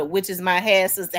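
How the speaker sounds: ordinary delivery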